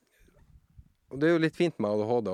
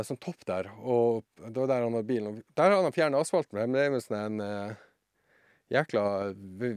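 ordinary delivery

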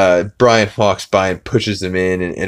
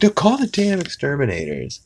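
higher, impatient voice of the wife; mock shrill refusal; 8 semitones higher than usual. Wife rejecting peace with fleas, mock high refusal. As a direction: snap that the pests must go.

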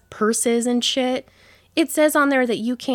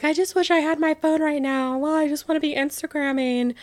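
higher, whiny mock voice of complaining fans; 7 semitones higher than usual. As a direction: parody the phone-addicted crowd. Mocking other concertgoers' complaints.

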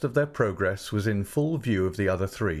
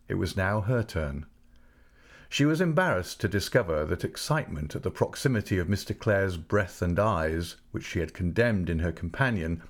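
ordinary delivery

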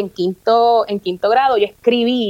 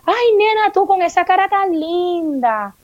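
high, drawn-out mock compliment; imitating gushing relatives; 7 semitones higher, slower than usual. Everyone telling the young girl about her pretty face, mock gushing. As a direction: coo it like a pitying busybody. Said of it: "todo el mundo me decía".

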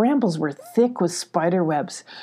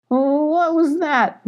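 high, very slow wary question; startled, stretched high query; alarmed question; 9 semitones higher, much slower than usual. Acted. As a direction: ask it drawn out and high, alarmed. Molly startled by a snap.

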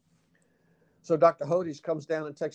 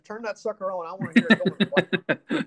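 laughing demand; 5 semitones higher, 4 dB louder than usual. Retold or imitated.

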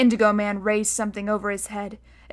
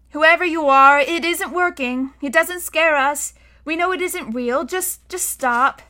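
raised pitch, deliberate challenge; defiant boy; 6 semitones higher, 5 dB louder than usual. A narrator's reading. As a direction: call it out to stand up to a monster. Boy defies monster in story.

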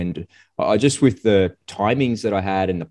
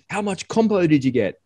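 much higher, prying question; nosy soldier; 11 semitones higher than usual. Retold or imitated. The soldier pressing about compensation, higher prying tone. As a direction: lightly play the bloke chasing compo. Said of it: "And he goes".

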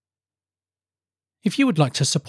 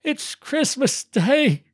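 high, slow, drawn-out marvelling; awed delight to himself; 11 semitones higher, much slower than usual. A narrator's reading. Scrooge realising to himself the day, marvelling. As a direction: savour it slowly in a high voice. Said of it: "said Scrooge to himself".

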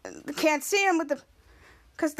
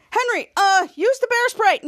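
raised, high acted command; urgent order; 5 semitones higher, 4 dB louder than usual. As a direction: call out an order mid-chase. Retold character gives urgent order.

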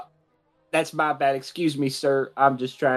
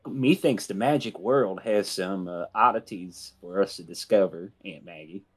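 mock old-fashioned; 6 semitones lower than usual. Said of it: "I said methinks".

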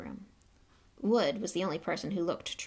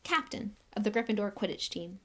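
ordinary delivery

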